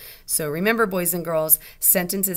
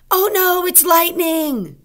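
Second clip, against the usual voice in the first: high, loud cry of alarm; frightened exclamation; 12 semitones higher, 9 dB louder, slower than usual. Shown as a demonstration. Teacher models a scared exclamation about lightning, frightened. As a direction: call out in mock fright, drawing it out. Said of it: "That would be an exclamation mark".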